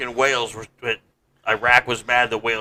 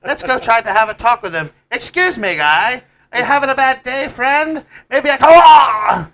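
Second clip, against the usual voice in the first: much higher, louder Canadian, laughing; shrill mock-cheerful Canadian bystander; South Park Canadian; 9 semitones higher, 8 dB louder than usual. Canadian bystanders shrugging off a stabbing in South Park accent. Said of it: "you sound like a guy from South Park".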